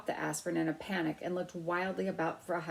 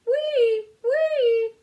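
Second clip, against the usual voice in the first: very high, much louder siren cry; hard siren wail; 13 dB louder than usual. A narrator's reading. Mouse speeding into elevator, very loud high siren. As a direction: blast the siren with all his might. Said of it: "said Ralph hard enough".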